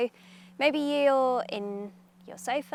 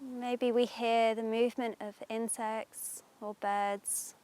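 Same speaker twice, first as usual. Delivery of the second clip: guided meditation; 3 dB louder than usual. Acted. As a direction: ease them into calm, unhurried.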